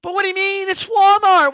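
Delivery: high, whiny entitled customer voice; entitled lady. Retold or imitated. A short-haired lady demanding to see the manager, high and whiny. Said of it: "with short hair and shit".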